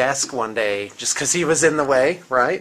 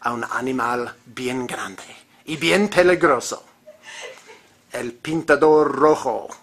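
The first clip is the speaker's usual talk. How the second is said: mock wildlife guide in Mexican Spanish; Mexican accent. Host presenting a dangerous beast, Mexican accent. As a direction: play a Mexican jungle guide. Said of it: "it's the Mexican".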